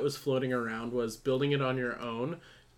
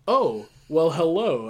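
louder, mock-suave ad greeting; awkward fake promo; 3 semitones higher, 7 dB louder, slower than usual. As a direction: play a clumsy, corny beer spokesman. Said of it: "so awkward and dumb".

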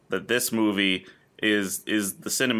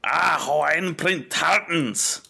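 slow, higher put-on generic UK accent; generic British accent; 7 semitones higher, slower than usual. Host musing on tartans, put-on generic UK accent. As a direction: drawl it in a vague non-English British accent. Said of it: "my generically, uh, non-English U K accent".

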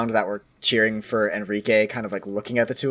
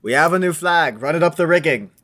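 raised, higher-pitched shipboard order; calling up; 6 semitones higher, 4 dB louder than usual. Acted. Arthur ordering the boy aloft, calling out. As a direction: call the order up the mast.

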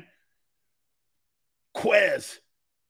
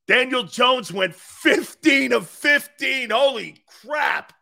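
excited shout; 7 semitones higher, 6 dB louder, slower than usual. Real, not acted. Reacting to practice stats.

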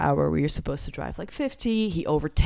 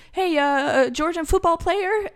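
high, questioning mock-boyfriend voice; lightly acted, high-pitched asking; hopeful query; 11 semitones higher than usual. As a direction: float it up as a tentative question.

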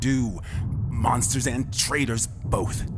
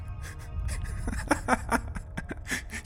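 villain laugh; 13 semitones higher, 6 dB quieter than usual. Acted.

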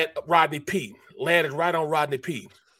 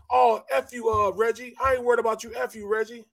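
retold cussing; 7 semitones higher than usual. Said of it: "He starts cussing again".

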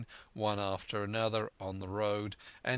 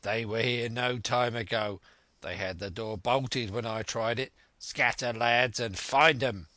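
put-on pirate leader, urgent; fierce ringleader voice, rising in force; commanding blind villain; 3 dB louder than usual. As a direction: build to a loud order to the gang. Blind Pew commands his gang.

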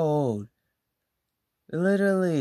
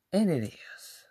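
breathy aside, no voice at all; real whisper; 7 dB quieter than usual. Real, not acted. Agreeing with viewers, a whispered aside. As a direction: let it slip out as a secret aside.

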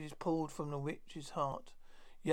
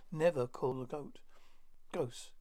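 usual speaking voice

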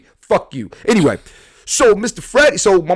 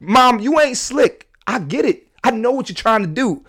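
knowing tease; 8 semitones higher than usual. Retold or imitated. Talking to mom in story.

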